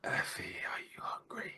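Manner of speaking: very soft, breathy whisper; hushed, creepy whisper; eerie whispered voice. Acted. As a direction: whisper it like an uncanny voice out of nowhere. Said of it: "hears the same voice saying".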